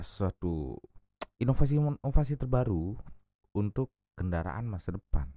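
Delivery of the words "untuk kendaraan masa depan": normal talking voice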